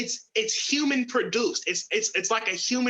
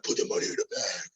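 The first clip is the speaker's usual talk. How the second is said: menacing robber; 4 dB quieter, faster than usual. Retold or imitated. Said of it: "But if they was like".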